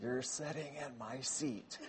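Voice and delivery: put-on quiet, slow, shaky old woman; barely there, like a frail elderly lady. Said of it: "in this quiet, frail voice, she a little shaky".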